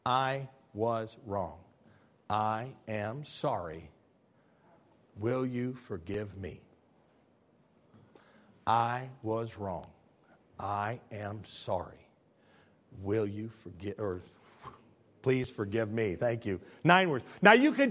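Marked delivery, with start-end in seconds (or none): 0.1-6.6 slow, soft and low apology; humble apology. Shown as a demonstration. 8.7-16.1 very soft, low, measured apology; humble apology. Shown as a demonstration.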